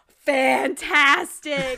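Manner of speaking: drawn-out praise. Real, not acted. Gushing over mask.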